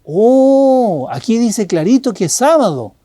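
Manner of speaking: an eager know-it-all friend; pointing friend. Retold or imitated. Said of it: "Y tu amigo dice".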